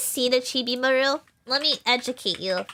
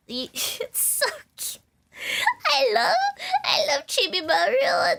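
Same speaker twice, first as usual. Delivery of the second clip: higher, half-laughing, half-crying gush; gush, voice cracking with delight; real overwhelmed fan squeal, pitched up; 13 semitones higher than usual. Real, not acted. Overwhelmed with love for character.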